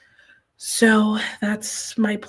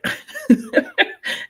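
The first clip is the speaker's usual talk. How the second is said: a sudden squeaky high laugh; high burst of laughter; 3 dB louder than usual. Real, not acted. Streamer laughing to her chat about staying awake, high laugh. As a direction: laugh out at yourself, high and bright. Laughs at starting sleepy sprint.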